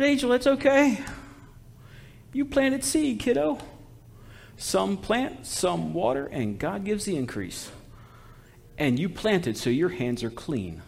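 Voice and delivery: re-enacted tender encouragement; gentle reassurance. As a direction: comfort an upset youngster, softly. Consoling an upset young corpsman.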